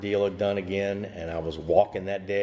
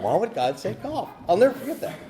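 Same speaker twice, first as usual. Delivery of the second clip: much higher, laughing in disbelief; 12 semitones higher than usual. Real, not acted. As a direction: giggle at the memory. Laughing at the memory.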